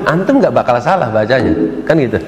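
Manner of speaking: ordinary talk, nothing marked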